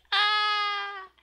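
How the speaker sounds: high, loud sustained "ah"; acted scream. Acting out the manga girl watching TV, screaming. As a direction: belt out a held, high mock scream.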